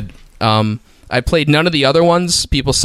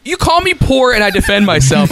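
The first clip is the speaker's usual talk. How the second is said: ordinary delivery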